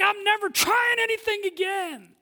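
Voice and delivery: imitating a hurt churchgoer yelling; hurt outburst. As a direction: blurt it out like someone fed up. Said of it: "You're like".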